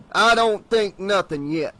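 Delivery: Tupper cutting in. Acted. Sheriff Tupper cuts off the protesting salesman, sharply. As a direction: cut him off hard. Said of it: "Tupper interrupted".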